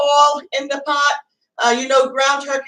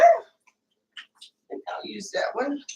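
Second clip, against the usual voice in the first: whisper; 8 dB quieter, much slower than usual. Real, not acted. Muttered aside while grabbing spoon.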